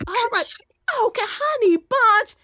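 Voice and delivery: high, syrupy Southern sweet-talk; Southern charm. Shown as a demonstration. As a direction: pour on Southern sweetness up high to win them over. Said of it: "throw that Southern charm".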